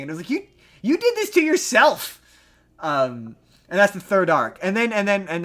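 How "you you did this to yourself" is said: loud, high-pitched retort; answering a character angry about the vote; exasperated retort. Retold or imitated. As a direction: throw it back in his face.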